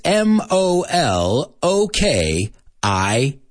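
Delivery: slow, letter by letter; each letter drawn out and separate; spelling out. Shown as a demonstration. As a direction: spell it out slowly for students. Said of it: "I'm going to spell some words for you".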